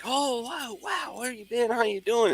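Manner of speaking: high, gushing greeting voice; mock high-pitched welcome; excited coworkers. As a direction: play the overjoyed old coworkers. Staff greeting him, voiced high.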